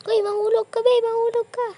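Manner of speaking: high, loud sing-song jinx "mahulog ka"; teasing taunt. Real, not acted. A kid teasing a friend on a jump, high sing-song taunt. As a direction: taunt them to slip off.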